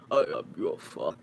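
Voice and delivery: very low, soft, breath-heavy villain voice; badly faked deep villain, hushed; bad movie villain impression. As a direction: drop way down into a whispery dark-father voice. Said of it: "I know, it's really bad".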